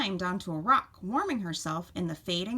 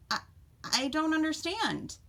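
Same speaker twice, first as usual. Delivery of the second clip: put-on higher-pitched, hesitant young animal; confused fawn; 7 semitones higher than usual. A narrator's reading. Fawn questioning Mother Doe, hesitant and confused. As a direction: stumble on the first word, puzzled.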